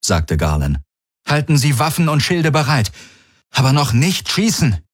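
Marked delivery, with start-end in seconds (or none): 1.3-4.8 firm order. A narrator's reading. Garland gives orders.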